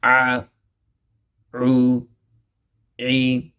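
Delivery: "a, u, e" with a squeezed throat catch; throat-squeezed ain. Shown as a demonstration. Teacher drilling ain from the middle of the throat. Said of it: "give a little stress in the throat".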